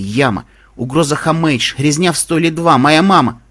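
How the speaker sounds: ordinary delivery, nothing marked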